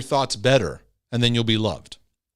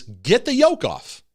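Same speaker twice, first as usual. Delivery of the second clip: higher, louder and slow, every word pressed; emphatic command; 9 semitones higher, 4 dB louder, slower than usual. Real, not acted. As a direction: urge them firmly. Preacher urging them.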